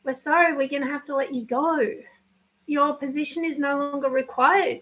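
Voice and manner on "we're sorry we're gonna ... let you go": usual speaking voice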